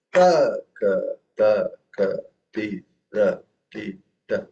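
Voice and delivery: first "Ta" strongest, each later syllable easing down. Shown as a demonstration. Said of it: "just go softer thereafter".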